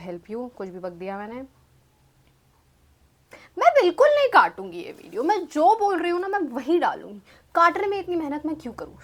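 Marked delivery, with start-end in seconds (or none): 3.6-9.0 high, loud, rapid mock protest; indignant, playful rant at full tilt; defiant outburst. Real, not acted. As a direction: refuse stubbornly, pitch jumping up. Playfully refusing to edit out fumbles.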